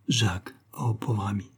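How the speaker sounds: soft whispered murmur. A narrator's reading. Madeleine murmuring to the wounded sergent, whispered. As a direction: breathe it out, barely a sound. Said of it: "sur ses lèvres un murmure passa".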